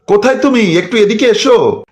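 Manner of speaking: high, carrying call across the house; mother summoning her daughter-in-law from another room. Acted.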